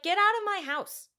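furious husband yelling at brothers; shout. Retold or imitated. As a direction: bark it like an angry host. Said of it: "he's like".

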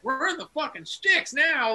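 high, loud quote of an exaggerated complaint; mock outcry. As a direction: exclaim it like you've reached the middle of nowhere. Voicing people outside New York.

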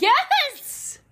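a high, loud cheer of agreement; excited yes. Real, not acted. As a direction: shout it out, thrilled. Cohost cheers the catchphrase.

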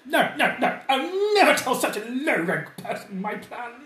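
high, loud, snooty aristocrat voice; haughty nobleman. Acted. The cornered noble snaps at the peasant party, high and shrill.

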